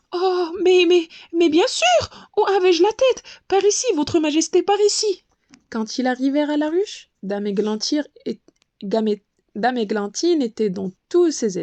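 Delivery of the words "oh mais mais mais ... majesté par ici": flustered eagerness. A narrator's reading. Butterfly flustered before the queen.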